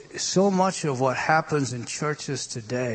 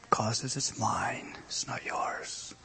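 slow whisper; 3 semitones lower, 10 dB quieter, slower than usual. Real, not acted. Preacher whispering God's words to the congregation. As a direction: whisper it, slow and much softer.

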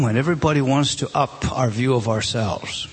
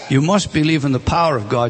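normal talking voice

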